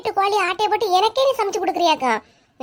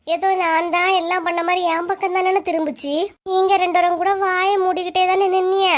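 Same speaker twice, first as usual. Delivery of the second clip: normal talking voice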